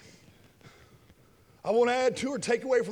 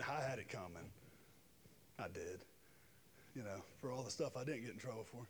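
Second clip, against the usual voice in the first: much softer, low, mumbled admission; sheepishly owning up, far softer; sheepish confession; 10 semitones lower, 18 dB quieter than usual. Real, not acted. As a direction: drop almost to a murmur, owning it.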